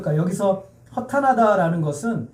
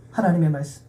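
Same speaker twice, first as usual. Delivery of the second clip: ordinary talk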